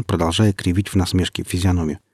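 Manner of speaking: ordinary delivery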